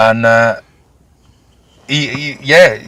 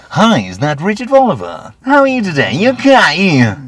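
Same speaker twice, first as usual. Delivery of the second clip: high, loud, sing-song sales patter; fake cheery cold caller; 10 semitones higher, 7 dB louder than usual. Cold caller ringing a stranger, chirpy and much higher. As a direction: put on a phoney salesman's sunny voice. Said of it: "rings you up and goes".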